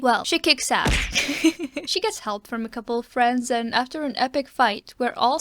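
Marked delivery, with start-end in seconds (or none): none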